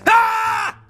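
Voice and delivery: short high barking yell; mock bark yell. Shown as a demonstration. Owner barking back at the dog, sudden high yell. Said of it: "bark back at him".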